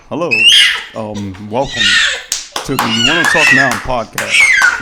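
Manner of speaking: mock dry podcast welcome; deadpan demo of a dull host intro; dry delivery. Shown as a demonstration. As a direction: say the welcome drily, to make a point. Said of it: "you want me dry like that".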